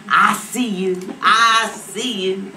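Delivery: loud, slow, drawn-out cheer; hyping approval. Retold or imitated. Gang members to newly nicknamed Beretta, drawn-out approval. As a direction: stretch it out admiringly.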